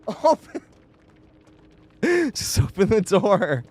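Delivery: mock high plea, slowing through a laugh; retold yell. Retelling how they yell at the fleeing teammate, a mock plea. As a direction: replay the moment half-laughing. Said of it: "and you're like".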